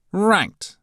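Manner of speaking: high, loud fussy professor voice; pompous professor taking charge; stern professor. A narrator's reading. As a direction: announce it like a pompous teacher.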